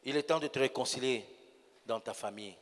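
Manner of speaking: hushed prayer invitation. Acted. Preacher softly invites the standing congregation to reconcile with God. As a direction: draw them quietly into prayer.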